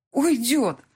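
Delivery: Уйдёт cried out as it hits her; Уйдёт rising in anguish. Acted. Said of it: "Он уйдёт".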